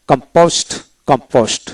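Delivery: ordinary talk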